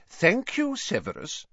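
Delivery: a hint of a firm old headmaster; lightly voiced firm Dumbledore; Dumbledore, firmly. A narrator's reading. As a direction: cut him off firmly. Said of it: "said Dumbledore firmly".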